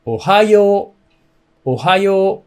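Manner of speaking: ohayo with a low o and a high hayo; Japanese pitch accent. Shown as a demonstration. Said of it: "o is a little low, hayo is a little high".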